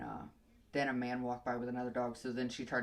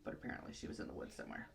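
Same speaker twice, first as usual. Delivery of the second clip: quick whispered aside; 3 semitones lower, 9 dB quieter, much faster than usual. Real, not acted. Trailing aside.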